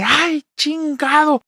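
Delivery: high, slow startled yelp while slipping; startled curse. Retold or imitated. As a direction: mom curses as she slips. Mom slipping on stairs. (madre maldice al resbalar, aguda y lenta)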